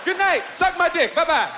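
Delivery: loud, punched farewell; mock show sign-off. Comic faking a curse-only act, loud sign-off. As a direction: belt it out to the crowd.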